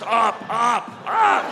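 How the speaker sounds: yelling up. Retold or imitated. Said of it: "everyone weirdly yells".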